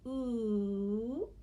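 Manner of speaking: "ooh" held long; isolated sound. Shown as a demonstration. Teacher gives moon's second sound, "ooh" held long. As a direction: sustain the vowel "ooh" on its own. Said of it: "The second sound is".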